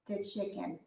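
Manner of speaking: ordinary delivery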